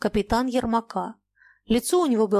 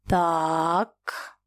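low, drawn-out ominous "Так"; ominous drawl; 3 semitones lower than usual. Acted. Mayakin sizing up the captain, ominous. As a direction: let it hang with menace. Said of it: "зловеще ... протянул Маякин".

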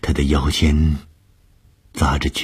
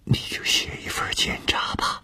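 hushed, secretive whisper; soft, confidential whisper; lowered voice; 6 dB quieter than usual. A narrator's reading. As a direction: drop to a whisper so nobody outside overhears. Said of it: "然后压低声音说".

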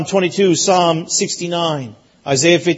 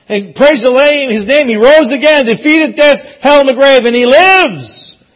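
rising, louder preaching cry; preaching at full force; 5 semitones higher, 5 dB louder than usual. Real, not acted. Preacher lifting the congregation in praise, louder. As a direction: lift the praise to a climax, slowly.

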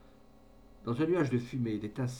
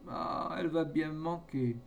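tearful lament; 5 semitones higher than usual. A narrator's reading. Farmer weeping as his haystack burns, acted tearful lament. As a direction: lament it in a high, weepy character voice. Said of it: "de grosses larmes lui coulaient des yeux".

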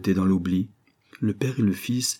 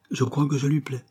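a touch bashful, pitched up; slightly embarrassed; 6 semitones higher than usual. A narrator's reading. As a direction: admit it shyly, with mild embarrassment. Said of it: "avec un peu d'embarras".

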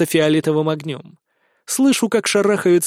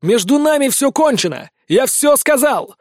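loud, high, angry yell; angry shouting; 7 semitones higher, 3 dB louder than usual. Acted. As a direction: yell to drive her away. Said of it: "вою".

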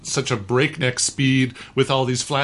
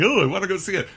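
mock whiny friend; 7 semitones higher, faster than usual. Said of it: "you keep saying".

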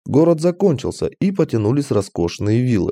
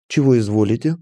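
lightly affected servile tone; a touch of put-on courtesy from a sly shopkeeper; feigned politeness. A narrator's reading. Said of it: "притворным голосом произнёс он". (слегка притворным голосом)

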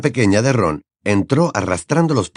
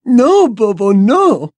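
sing-song laugh; 13 semitones higher, 6 dB louder, much slower than usual. Acted. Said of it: "con una risa cantarina".

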